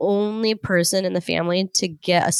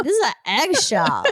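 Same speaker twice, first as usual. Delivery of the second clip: higher, incredulous retort; exasperated disbelief; 4 semitones higher than usual. Retold or imitated. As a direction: point out the obvious, fed up. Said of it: "And I'm like".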